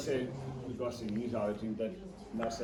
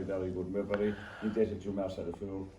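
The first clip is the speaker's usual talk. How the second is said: ordinary talk, nothing marked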